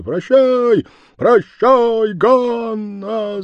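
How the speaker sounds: high, loud chorus of lads; crowd shouting. Acted. Lads hanging on his neck shout goodbye, loud. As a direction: holler it together, teasing. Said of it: "закричало несколько парубков".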